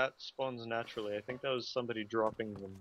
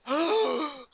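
loud shocked gasp; sharp gasp; 9 dB louder than usual. Acted. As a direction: gasp in mock horror. Zombie bunny appears.

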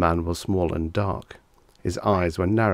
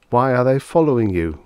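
peevish; 5 semitones higher, 8 dB louder than usual. A narrator's reading. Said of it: "the young man said peevishly".

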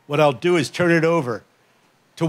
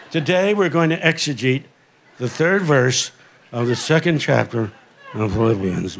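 low, droning, sinking voice; 5 semitones lower than usual. Retold or imitated. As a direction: drone it like a boring sermon.

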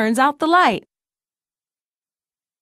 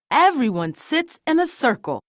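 ordinary delivery, nothing marked

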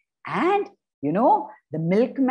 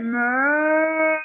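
a playful higher moo; cow moo; 5 semitones higher than usual. Acted. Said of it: "the cow went".

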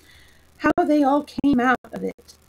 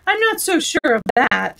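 defiant; 6 semitones higher, 5 dB louder than usual. Acted. Unicorn quarrelling with lion.

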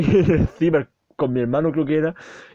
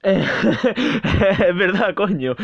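laughing; 6 semitones higher, much slower than usual. Real, not acted. Laughing at own clown fear.

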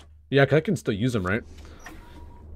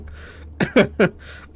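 breathy giggle; 13 semitones higher, 7 dB louder than usual. Real, not acted. Laughing at his own joke.

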